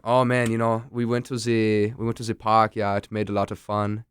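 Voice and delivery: imitated German-accented English, "the" turned to "ze". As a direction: do a German learner chatting in English. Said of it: "I love the German accent".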